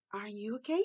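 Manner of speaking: gently check, lifting "okay"; question tune. Shown as a demonstration. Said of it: "a gente tá fazendo uma pergunta". (pergunta branda, subindo em "okay")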